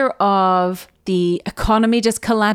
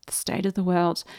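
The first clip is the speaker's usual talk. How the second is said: the state of the world dropped quieter, trailing off; echoing people's gloom, quieter and sinking; softer fading lament; 4 semitones lower, 6 dB quieter than usual. Retold or imitated. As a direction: repeat the state of the world softly, like a weary sigh. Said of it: "I heard people starting to say".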